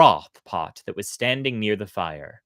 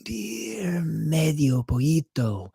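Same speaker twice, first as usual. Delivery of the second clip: high, slow, fading little voice; small voice of a dying spark; weak spark voice; 6 semitones higher, slower than usual. A narrator's reading. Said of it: "said the spark".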